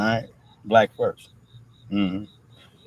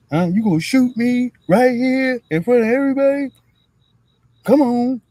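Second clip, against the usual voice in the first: much higher, louder defiant dare; acted movie standoff; 13 semitones higher, 6 dB louder than usual. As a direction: dare him to pull the trigger. Acting out the movie standoff scene.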